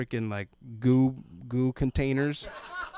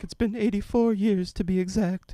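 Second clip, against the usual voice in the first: put-on higher old woman voice; old Rose; 9 semitones higher, 4 dB louder than usual. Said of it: "reminded me of Rose from the Titanic".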